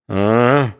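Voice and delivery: stretched, understanding "a"; knowing drawn-out "a". A narrator's reading. Mitka grasps he is being driven out, drawn-out knowing sound. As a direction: let it dawn on you slowly. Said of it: "понимающе протянул".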